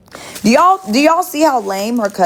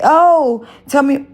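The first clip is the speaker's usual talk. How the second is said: high, mock-sweet fishing for gossip; lightly voiced nosy asker, higher; mock sweet prying; 7 semitones higher, slower than usual. To the coworker, the question she never asked, mock sweet. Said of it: "I didn't say".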